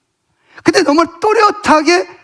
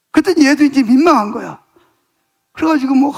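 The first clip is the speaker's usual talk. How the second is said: ordinary talk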